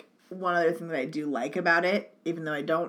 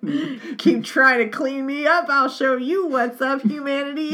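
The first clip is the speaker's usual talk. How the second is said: high, loud, slow taunting voice; cackling Mary; 7 semitones higher, 7 dB louder, slower than usual. Retold or imitated. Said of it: "She's like".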